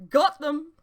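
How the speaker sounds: high, loud gleeful cry. A narrator's reading. Talgoth showing the books to friends, gleeful cry. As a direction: burst in triumphant. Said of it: "he cried gleefully".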